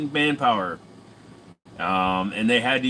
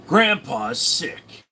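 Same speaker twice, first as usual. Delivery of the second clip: slow dramatic title read; mock trailer title, drawn out; 5 semitones higher, much slower than usual. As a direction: announce it like a film title.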